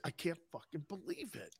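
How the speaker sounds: very hushed, retold disbelief; barely audible aside to yourself; disbelieving mutter. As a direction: say it under your breath, as if stunned. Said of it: "you must just go like this".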